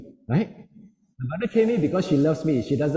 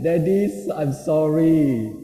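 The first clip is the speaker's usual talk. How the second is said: slow, drawn-out tearful apology; mock-pitiful child saying sorry; imitated crying child; 4 dB louder, much slower than usual. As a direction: mimic a sobbing little boy. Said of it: "When my son looked at me crying".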